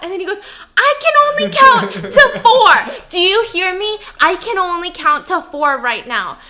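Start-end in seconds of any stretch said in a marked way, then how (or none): 0.8-4.0 high and loud, then easing; hammed-up frantic plea; frantic insistence. Husband pleading loudly with wife. 4.2-6.4 a touch louder, at normal pitch; mildly raised insistent repeat; calmer insistence. Retold or imitated. Husband pleading with wife.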